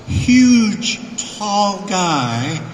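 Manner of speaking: "huge" pitched high and leaned on, then "tall guy"; dramatic stress on "huge"; drawn-out emphasis. Real, not acted. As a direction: hit HUGE high, pause before it for size. Emphasising the man's size.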